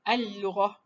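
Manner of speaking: "al-lughah" with a single long L; doubled lam. Shown as a demonstration. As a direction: hold the l through "al-lughah" without a break.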